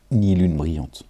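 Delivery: ordinary talk, nothing marked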